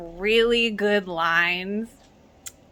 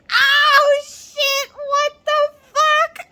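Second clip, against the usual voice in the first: high, loud, laughing shock; shocked shriek; 7 dB louder, much slower than usual. Retold or imitated. Reacting to the drama, a high laughing shriek of shock. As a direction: squeal it out, scandalised and delighted.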